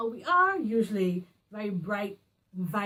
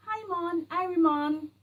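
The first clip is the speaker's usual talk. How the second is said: higher, mock movie Caribbean accent; put-on Caribbean greeting accent, pitched up; 7 semitones higher, 4 dB louder than usual. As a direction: imitate a clichéd screen Caribbean accent. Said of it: "West Indian people as saying".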